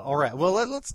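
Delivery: normal talking voice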